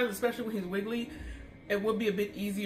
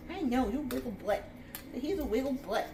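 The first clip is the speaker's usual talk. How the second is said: high, playful doggy voice; pet talk; 4 semitones higher than usual. Real, not acted. Teasing the wriggling dog.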